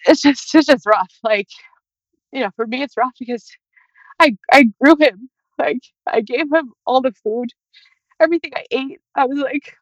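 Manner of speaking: real tears, voice high and breaking as she talks; crying while talking. Real, not acted. Mother grieving her unborn son, talking through real tears. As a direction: push the words out through crying.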